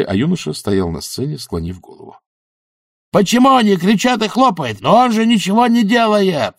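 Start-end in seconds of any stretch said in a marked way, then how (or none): 3.1-4.8 high shout over the crowd roar. A narrator's reading. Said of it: "прокричал Эфтерп на ухо Бизону". 4.8-6.5 high indignant shout. A narrator's reading. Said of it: "прокричал Эфтерп на ухо Бизону".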